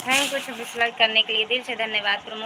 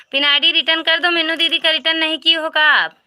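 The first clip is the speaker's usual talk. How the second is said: higher, louder, fast scolding; exasperated call-out; 6 semitones higher, 6 dB louder, faster than usual. Real, not acted. Calling out to the viewer, annoyed about the return. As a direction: raise the voice in annoyance.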